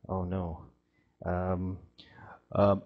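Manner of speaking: frightened tree, hushed; panicked whisper. Acted. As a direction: whisper in dread as danger nears.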